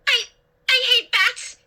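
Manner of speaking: high, panicky stammer; frightened high-pitched stutter. A narrator's reading. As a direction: stumble on the first word, then cry out. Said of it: "I stammered".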